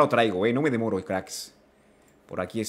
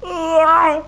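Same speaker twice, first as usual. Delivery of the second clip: high-pitched yell of dismay; startled cry; 10 semitones higher, 4 dB louder than usual. Real, not acted. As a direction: cry out after the scare. Caught in the game. (grito agudo de susto)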